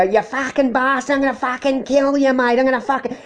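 hint of screeching woman. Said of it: "they will go".

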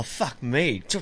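ordinary delivery

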